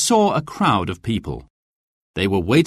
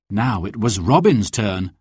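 ordinary delivery

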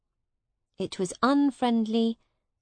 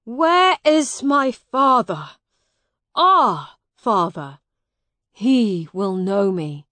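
desperate demand; 9 dB louder than usual. Acted. Miles demands his father from Hugh, loud and desperate. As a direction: call out for someone to vouch for you.

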